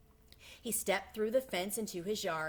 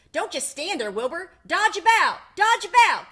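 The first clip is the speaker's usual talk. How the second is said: very high, loud honking goose cries; shrieking goose; 10 semitones higher, 13 dB louder than usual. A narrator's reading. Goose urging Wilbur to escape, shrill frantic cries. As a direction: screech the orders like a frantic goose. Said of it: "cried the goose".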